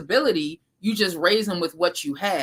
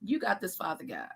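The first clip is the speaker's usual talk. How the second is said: soft prayer; 2 semitones higher, 11 dB quieter than usual. Retold or imitated. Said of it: "But I'll be like".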